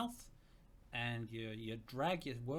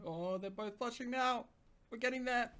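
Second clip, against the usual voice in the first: higher, gleeful squeal of delight; excited aside; 10 semitones higher than usual. Real, not acted. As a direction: gush over the couple. Reader reacts excitedly to the romance.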